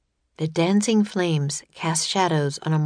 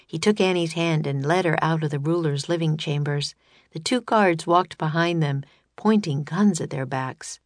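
plain reading voice

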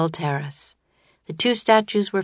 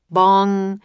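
mock bell bong; 3 semitones higher, 6 dB louder than usual. A narrator's reading. Clock striking.

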